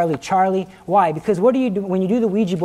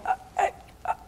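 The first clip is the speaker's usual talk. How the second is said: strained, stuck "uh"; choked grunts; 10 dB quieter, much faster than usual. Retold or imitated. Man held by the throat, choked attempts to speak. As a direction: imitate failing to speak while choked. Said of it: "and I couldn't speak".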